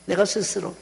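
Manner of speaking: ordinary delivery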